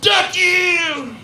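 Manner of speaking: high, drawn-out mock shout; hammed-up high holler, last word stretched; stepdaughter's angry yell. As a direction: scream it as the childlike stepdaughter. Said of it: "She's like".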